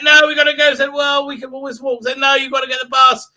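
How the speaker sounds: high-pitched mock argument; hammed-up insistent back-and-forth; mock bickering. Arguing over walking or bus, mock insistent.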